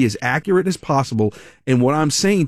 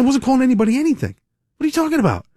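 baffled protest; 10 semitones higher, faster than usual. Retold or imitated. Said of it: "I said".

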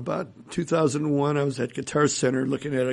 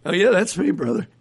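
lightly voiced guitarist, pitched up and louder; imitated breezy reply; 6 semitones higher, 4 dB louder than usual. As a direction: play his easy, higher-pitched confirmation. Guitarist's cheerful phone answer, acted.

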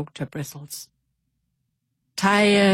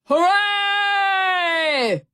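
much higher, loud joyful cheer; the pirates cheer at the squeal, high and loud; 13 semitones higher, 4 dB louder than usual. A narrator's reading. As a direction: shout it out as a crowd. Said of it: "the pirates cheered".

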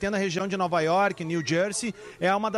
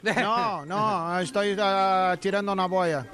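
fake Spanish; much slower than usual. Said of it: "quer imitar o mendigo agora".

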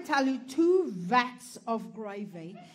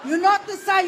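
shout; 7 semitones higher, 9 dB louder than usual. Real, not acted. Ranting at crowd.

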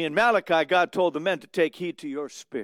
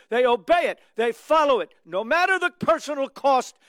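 higher, louder, driving sermon voice; fiery preaching; 8 semitones higher, 7 dB louder than usual. Acted. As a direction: preach it out with rising fervour. Preaching at full force.

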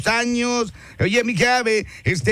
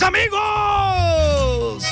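high, very long drawn-out shout; announcer's hype shout; 9 semitones higher, much slower than usual. Real, not acted. DJ plugging the Tres Amigos concert, hype shout. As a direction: hold the word and let it slide down.

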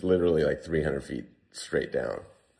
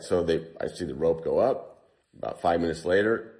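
usual speaking voice

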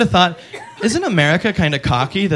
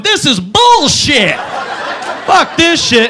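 high slow outraged yell; God backstage on Maury, furious and loud; angry God; 11 semitones higher, 4 dB louder, much slower than usual. Retold or imitated. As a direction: rage backstage at the screen. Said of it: "just going".

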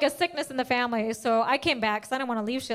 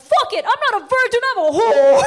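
much higher and louder, yelled in a meltdown; breakdown shout; 9 semitones higher, 10 dB louder than usual. Retold or imitated. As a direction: yell it out in a public breakdown. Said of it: "nervous breakdown".

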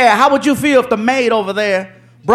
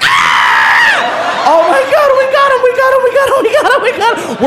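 gleeful shouting; 12 semitones higher than usual. Retold or imitated. Pranksters in the hallway, shouting gleefully. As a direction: crow in triumph, laughing.

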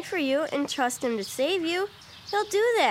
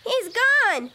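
higher-pitched, dismayed cry; distressed; 3 semitones higher than usual. Acted. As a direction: call anxiously for the lost horse. Her horse has run off.

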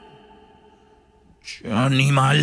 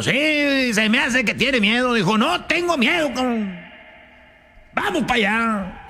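high indignant compadre; 10 semitones higher than usual. Acted. Hunters quarrel in the joke.

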